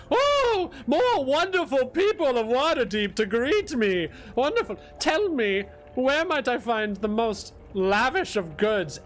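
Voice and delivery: haughty noble lady. Acted. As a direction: gush like a pampered noblewoman. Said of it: "And she goes".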